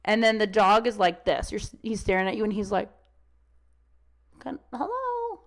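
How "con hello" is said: much higher, slow, dreamy hello; distracted dog. Dog ignoring its owner, much higher and slow. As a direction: voice the dog mooning at the other dog.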